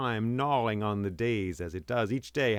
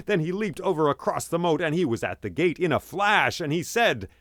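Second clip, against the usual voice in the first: higher, louder action storytelling; urgent, raised storyteller's voice; excited battle narration; 5 semitones higher, 4 dB louder than usual. A narrator's reading. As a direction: tell the bear's attack at full excitement.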